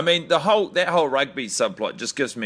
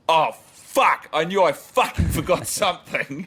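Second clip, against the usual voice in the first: the sudden dread of a forgotten job; panicked hotel manager; 5 semitones higher than usual. Pretend. Manager realising he forgot the balls, hammed-up panic. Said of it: "he's like".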